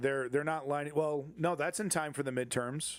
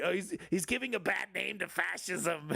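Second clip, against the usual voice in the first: aggrieved comic dictator, pitched up; high mock complaint, breaking into laughter; Adolf Hitler; 6 semitones higher than usual. Comic voicing a dictator offended for fascism, high griping voice. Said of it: "It's like Adolf Hitler. He's like".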